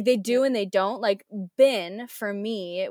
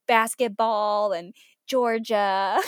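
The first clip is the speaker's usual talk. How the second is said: lightly exaggerated American accent, drawn out; slow, teasing take on American vowels; mocked American accent; 4 dB louder, much slower than usual. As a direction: imitate students mocking an American accent. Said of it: "they'll mock me also".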